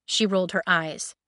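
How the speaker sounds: ordinary delivery, nothing marked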